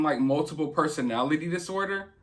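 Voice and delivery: normal talking voice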